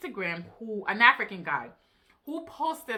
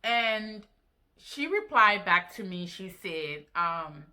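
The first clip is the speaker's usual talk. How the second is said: ordinary delivery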